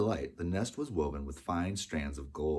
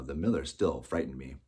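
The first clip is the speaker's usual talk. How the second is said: usual speaking voice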